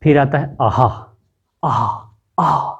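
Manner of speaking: आहा breathed out from the throat; आहा with a glottal breathy opening; throat sound demo. Shown as a demonstration. Teacher demonstrates to pupils, throaty आहा. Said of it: "ग्रोटल से आ रही है".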